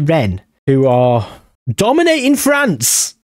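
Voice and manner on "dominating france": surprised. Real, not acted. Surprised at Rennes topping league.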